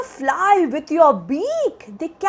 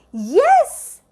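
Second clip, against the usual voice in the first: plain reading voice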